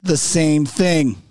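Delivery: each word slowly spelled out; slow emphatic. Shown as a demonstration. Texting the guest, slowly dictating each word. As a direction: drag it out, word by word. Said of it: "I'm gonna text him".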